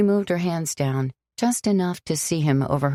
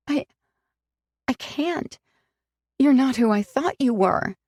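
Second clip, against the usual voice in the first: high, halting, then firmer; broken refusal; 9 semitones higher than usual. Acted. Sam refusing to forgive Alex, halting and hurt. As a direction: force the words out.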